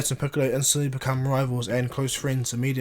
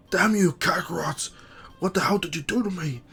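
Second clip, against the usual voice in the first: angry outburst; 6 semitones higher than usual. Retold or imitated. Raditz rages at Kakarot after the mind flash, angry shout. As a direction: curse your brother out in disbelief. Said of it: "He's like".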